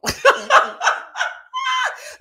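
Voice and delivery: a big, high, loud burst of laughter; loud high cackle. Real, not acted. Host cracking up at her own jail joke with a loud, high laugh.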